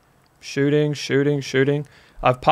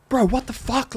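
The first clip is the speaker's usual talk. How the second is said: retold screaming back; 10 semitones higher, 4 dB quieter than usual. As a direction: replay your shocked yell, held back. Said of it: "Just screaming back at him".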